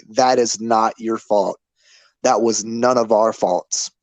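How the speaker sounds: normal talking voice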